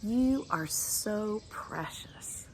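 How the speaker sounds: slow breathy whisper of precious; soft slow whisper of endearment. Shown as a demonstration. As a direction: whisper it to them tenderly, slowly. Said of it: "So let's do that for you".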